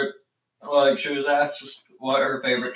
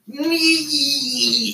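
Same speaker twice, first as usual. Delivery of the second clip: long, high squealed imitation; mocking little-brother cry, stretched high; mock toddler squeal; 13 semitones higher than usual. Mocking brother's quote from video.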